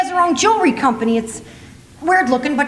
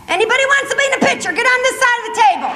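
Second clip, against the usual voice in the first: mock call from Jesus at supper, high and fast; hammed-up shout to gather the apostles; high, fast mock call to the disciples; 9 semitones higher, faster than usual. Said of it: "You think Jesus stood up and said".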